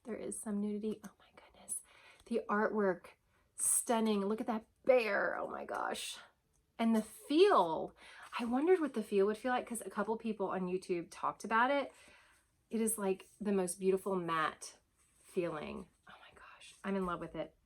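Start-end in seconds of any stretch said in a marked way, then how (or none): 1.0-1.8 breathless whispered awe; awed whisper. Real, not acted. Vlogger admires the card art in a whisper. 16.1-16.8 soft whispered delight; awed whisper. Real, not acted. Vlogger feels the matte cards, whispering in awe.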